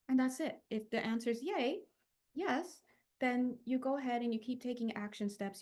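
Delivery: ordinary talk, nothing marked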